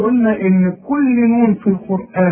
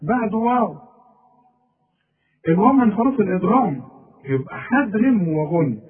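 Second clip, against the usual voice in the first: usual speaking voice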